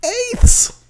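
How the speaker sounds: EIGHTHS shot way up high and loud; loud, very high disbelief on EIGHTHS; incredulous repeat. Real, not acted. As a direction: squeal the word EIGHTHS in disbelief.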